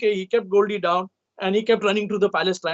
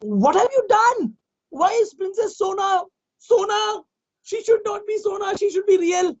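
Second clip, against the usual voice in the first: queen scolding the king; upset queen; 13 semitones higher than usual. A narrator's reading. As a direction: confront the husband. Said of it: "she said".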